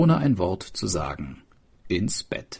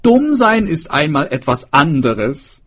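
a higher, louder tailor voice; tailor approving; 7 semitones higher, 7 dB louder than usual. A narrator's reading. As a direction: agree with relish.

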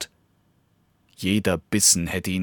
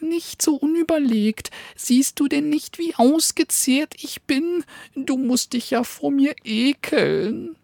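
high, pleading puppy voice; squeaky dog begging for mercy; pleading little dog. Acted. Frightened little dog begs the hungry wolf, high and pleading.

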